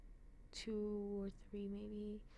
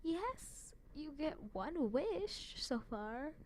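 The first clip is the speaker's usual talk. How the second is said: high, slow excited cheer; cheering fan; 6 semitones higher, much slower than usual. Retold or imitated. Vlogger cheering the heroine's wish, high drawn-out cheer. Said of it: "and I'm like".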